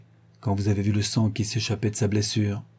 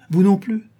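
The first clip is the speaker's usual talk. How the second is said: non punched higher and louder; stress on non; 9 semitones higher, 4 dB louder than usual. Acted. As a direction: hit non hard, accusing. Accusing the second maid.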